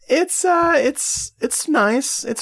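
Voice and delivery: squeaky-high, reluctant approval; high, hedging praise. Real, not acted. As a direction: pitch it way up as you hedge faint praise. Faint praise for the documentary.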